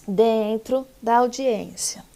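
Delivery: usual speaking voice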